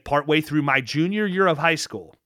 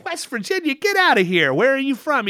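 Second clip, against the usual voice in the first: high-pitched, incredulous echo and questions; playful, pitched-up astonishment; retold disbelief; 10 semitones higher than usual. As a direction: act out amazed disbelief at a coincidence. Retells his disbelief at stranger's hometown.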